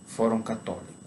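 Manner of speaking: usual speaking voice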